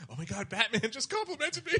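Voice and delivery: giddy high-pitched squeal of words; little-kid fanboy thrill. Retold or imitated. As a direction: gush like a starstruck child, laughing. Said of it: "That's only a little kid".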